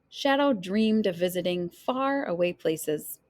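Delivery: usual speaking voice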